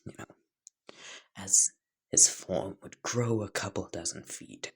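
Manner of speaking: plain reading voice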